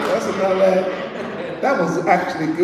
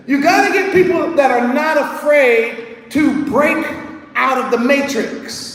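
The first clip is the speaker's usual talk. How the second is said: preaching at full force; 5 semitones higher than usual. Acted. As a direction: drive the point home slowly.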